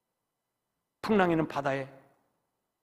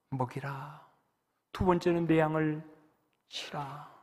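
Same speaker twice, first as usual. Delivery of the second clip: soft, mostly unvoiced storytelling; hushed whisper; 5 dB quieter than usual. Pastor whispering Jesus asking Peter about love. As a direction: whisper the tender question.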